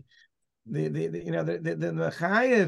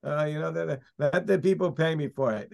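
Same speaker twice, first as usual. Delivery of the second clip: ordinary talk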